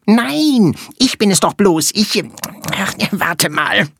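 high, quick little flea voice; squeaky tiny creature. Acted. Flea character speaks.

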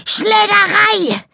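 schrilles Koboldgebrüll, langgezogen; gebrüllt. Acted. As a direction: aus voller Kehle kreischen. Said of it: "brüllte er aus voller Kehle". (lauthals gebrüllt wie ein Kobold)

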